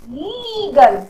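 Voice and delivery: "लीगल" with a stretched ee, falling at the end; long ee. Shown as a demonstration. Teacher showing long ee, stretching "लीगल". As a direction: draw out the first vowel of "लीगल". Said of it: "इसमें थोड़ा लंबा खिंच रहा है".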